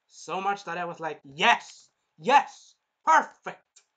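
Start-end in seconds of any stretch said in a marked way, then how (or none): 1.2-3.8 loud, higher triumphant shouts; cheering. Real, not acted. Viewer cheering as the hero wins, loud triumphant shouts. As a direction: cheer him on.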